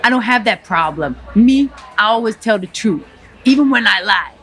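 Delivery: put-on lightly Cuban-accented gangster rant; defiant Scarface tirade, a hint of accent; Tony Montana. Tony Montana sneering at the restaurant crowd, light Cuban accent. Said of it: "to Tony Montana".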